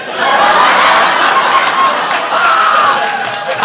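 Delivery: very high, breathless shriek of laughter. Retold or imitated. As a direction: let the laugh peak and squeal. Guy laughing hard.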